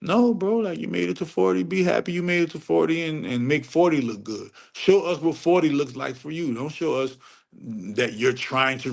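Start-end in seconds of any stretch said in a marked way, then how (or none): none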